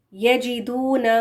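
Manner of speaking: ordinary delivery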